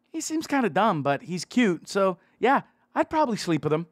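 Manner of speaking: put-on a light, teasing girly voice; French girl's reply. French girl judging the narrator to her friend, lightly teasing. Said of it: "To which the other one replied".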